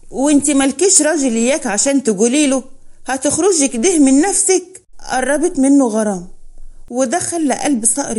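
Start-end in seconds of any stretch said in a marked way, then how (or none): none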